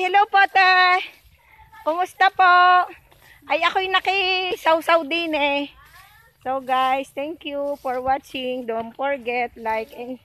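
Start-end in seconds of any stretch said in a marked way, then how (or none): 0.0-5.6 calling out. Real, not acted. Greeting neighbour from afar.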